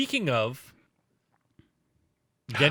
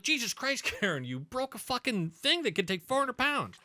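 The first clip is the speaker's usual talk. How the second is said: retold teasing jab; 10 semitones higher than usual. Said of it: "I'm like".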